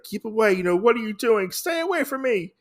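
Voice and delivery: high-pitched imitation of a frightened coward; mock cowardly fear. Oda to the advancing Lady Asano, mock frightened pleading. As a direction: plead in a put-on cowardly squeal. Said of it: "Oda is trembling in fear, coward".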